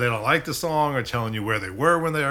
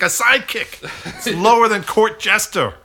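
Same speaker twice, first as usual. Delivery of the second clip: much higher, louder mock outrage through laughter; mock indignant laughing; 9 semitones higher, 8 dB louder than usual. Snubbed sidekick complaining to the host, laughing mock outrage. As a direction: laugh while playing hurt. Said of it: "He's mad at me".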